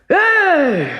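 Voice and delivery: a giant showing off his strength; triumphant shout. A narrator's reading. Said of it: "With a triumphant shout".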